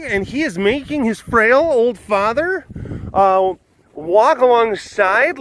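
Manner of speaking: high-pitched, hammed-up indignation; pearl-clutching passer-by act; mock outrage.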